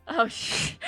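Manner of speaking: normal talking voice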